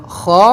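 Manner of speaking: loud "خا" scraped from the throat; throaty letter demo. Shown as a demonstration. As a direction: force out a long "خا". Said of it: "خا کی طرح ہی غین ہم کو بولنا ہے".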